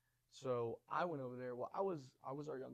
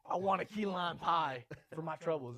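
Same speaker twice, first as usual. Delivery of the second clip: loud, slow, higher-pitched demand; demanding customer; 4 semitones higher, 9 dB louder than usual. Retold or imitated. Aggrieved diner demanding compensation from manager, loud mock demand. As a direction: imitate an entitled diner, hammering out the order.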